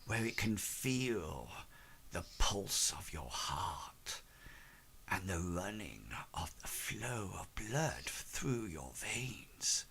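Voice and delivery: low, slow, hushed and unsettling; eerie slow whisper. Acted. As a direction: breathe it out like a secret ritual. Master describes amulet feeling the pulse.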